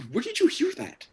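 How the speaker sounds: loud, flustered, pitch jumping high; hammed-up panicky interrogation; defensive. Insulted dad questioning his son about Mama, loudly defensive. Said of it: "I started getting defensive too".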